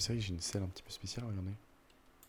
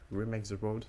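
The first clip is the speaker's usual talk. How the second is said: louder, exaggerated English accent; 3 semitones higher, 5 dB louder, slower than usual. Shown as a demonstration. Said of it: "J'ai un accent anglais tout pourri".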